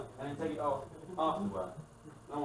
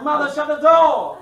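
loud, high shout, slow; 18 dB louder, slower than usual. Retold or imitated. Son catching mum naked, yelling loud and high. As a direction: yell it from the doorway in shock. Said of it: "She goes".

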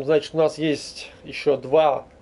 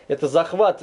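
ordinary delivery

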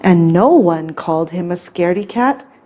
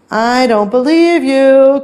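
higher, sing-out call; 6 semitones higher, 4 dB louder than usual. A narrator's reading. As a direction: shout back from afar, storybook style. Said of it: "called out Snowy".

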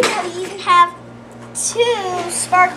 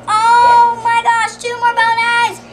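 excited discovery; 5 semitones higher, 5 dB louder than usual. Real, not acted. Child finding more ponies in the bag, thrilled exclamation. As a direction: stretch it out, thrilled and raised.

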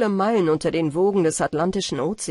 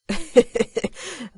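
a short, open burst of laughter; amused chuckle before speaking; hearty laugh; 5 semitones higher than usual. A narrator's reading. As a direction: laugh as the penny drops. Jack laughs at the Nix.

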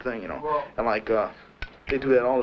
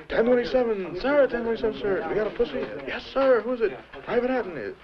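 high, shouted recruit report to the sergeant; 10 semitones higher than usual. Retold or imitated. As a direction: rattle it off like a recruit at attention.

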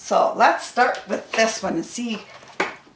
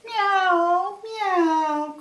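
high, drawn-out meows; long high storybook kitty call; cat meow; 9 semitones higher than usual. A narrator's reading. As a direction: cry it like a cat. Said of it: "cried the cat".

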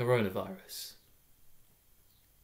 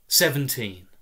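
ordinary delivery

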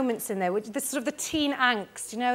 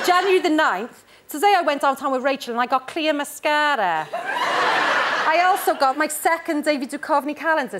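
put-on Barry accent; 3 semitones higher than usual. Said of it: "I can't do it in a Barry accent".

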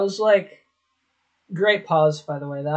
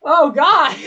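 higher, laughing groan; real laughing; 9 semitones higher, 6 dB louder than usual. Real, not acted. Cringing at an old clip, real laughing groan. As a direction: laugh through the words for real.

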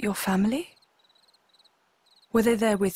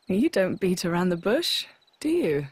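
usual speaking voice

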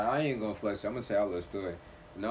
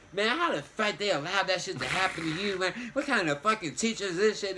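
a hint of higher, ranting mom; mom going ham; 7 semitones higher than usual. Mom ranting about the teacher on the drive home. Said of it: "going ham".

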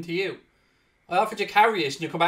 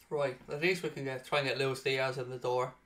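usual speaking voice

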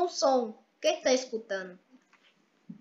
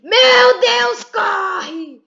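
panicked shout while fleeing; being chased; 3 semitones higher, 5 dB louder, much slower than usual. Real, not acted. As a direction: yell in alarm, running away. Fleeing killer clown in game.

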